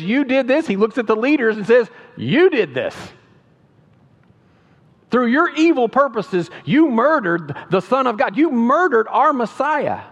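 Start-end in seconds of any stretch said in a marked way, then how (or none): 0.0-0.7 loud, high pointed accusation; accusing shout. Acted. Peter to the crowd, a loud pointed accusation. 2.2-10.0 louder, higher, slowing on Messiah; fierce accusation. Acted. Peter to the leaders who crucified Jesus, fierce accusation.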